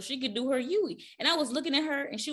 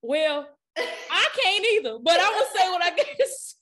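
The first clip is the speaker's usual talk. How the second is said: laughing reply; 9 semitones higher, 7 dB louder than usual. Retold or imitated. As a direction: answer while cracking up. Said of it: "I was just like".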